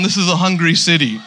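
normal talking voice

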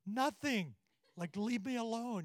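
put-on sulky kid. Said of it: "And he had, like, a scowl".

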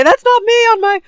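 high, loud mock horror; loud, high-pitched horrified protest, hammed up. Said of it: "they're usually horrified".

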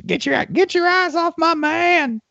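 high, slow possessive voice; guard your man like a jealous partner; jealous school voice. Retold or imitated. A school warning rivals off its coach, high and slow. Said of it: "I always love this".

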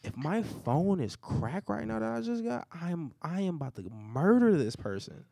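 high, slow, emphatic mock fury; retold outrage. Storyteller re-enacts threat over his dropped phone, drawn-out hammed-up anger. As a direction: drag out each word, high and loud, as a threat retold.

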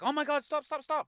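high, loud panicked pleading; mock fear. Scene: imagining the caught woman pleading with the mate, high mock-panicked cry. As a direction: fake a frightened plea to stop. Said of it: "Just jumps into fear".

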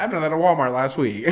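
ordinary talk, nothing marked

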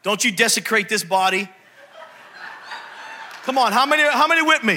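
usual speaking voice

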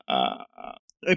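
choked, strained and faltering; words caught mid-choke; choking on a drink. Real, not acted. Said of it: "peraí que eu engasguei".

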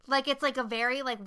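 ordinary talk, nothing marked

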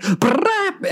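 high trilled sports-car rev; rolled-lip engine burst; imitated engine revving. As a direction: imitate a Lamborghini's brief roar. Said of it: "a Lamborghini that's like".

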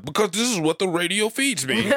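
high put-on complainer voice, amused; mock helpless excuse. Mimicking the complainer's helpless answer.

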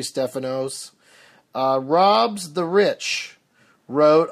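ordinary delivery

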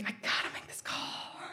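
soft whispered decision; whispered resolve. Retold or imitated. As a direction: whisper it to yourself, resigned. Resolving to call the dad.

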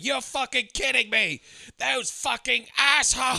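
high, drawn-out mock scream; imitated screaming. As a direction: act out an outraged screaming fit, stretching the words. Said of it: "And he's just screaming".

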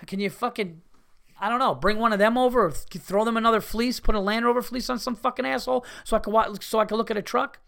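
fed-up customer snapping at salespeople; exasperated rant. Retold or imitated. His angry retort quoted.

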